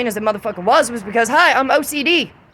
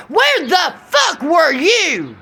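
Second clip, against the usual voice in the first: furious shout; 6 semitones higher, much slower than usual. Real, not acted. Angry rant at her accusers.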